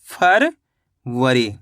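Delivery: February as a long rising फर, a pause, then वरी; slow split reading. Shown as a demonstration. Teacher reading back the finished word, slowly split in two. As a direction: read February very slowly in two separated parts. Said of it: "तो मिलके बन गया ये".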